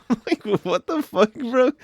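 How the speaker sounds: high-pitched, incredulous and laughing; laughing disbelief. Retold or imitated. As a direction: crack up in retold disbelief. Laughing reaction to customer's jibe.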